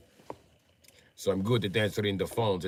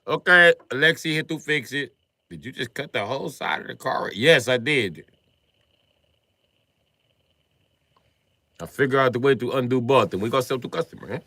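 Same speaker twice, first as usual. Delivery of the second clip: put-on Russian accent; 4 semitones higher, 6 dB louder than usual. Acting Alexei at the junkyard.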